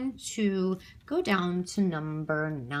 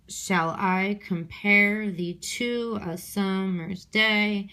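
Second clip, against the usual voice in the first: slow iambic scansion of "Shall I compare thee to a summer's day"; measured beat, leaning on I, PARE, SUM and DAY; metrical stress; slower than usual. Shown as a demonstration. Teacher saying the line over to herself, stressed beats marked. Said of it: "when I say it over to myself".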